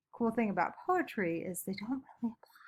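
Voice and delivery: fast, soft conspiratorial whisper. Shown as a demonstration. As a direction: whisper it quickly like a secret to the class. Repeats earlier line as aside.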